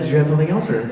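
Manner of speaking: ordinary talk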